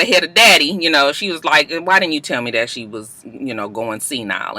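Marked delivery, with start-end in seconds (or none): 1.7-4.6 low, hushed mock aside; hushed question. Mama quietly asks daddy about senility, low and hushed. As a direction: ask it low, out of earshot.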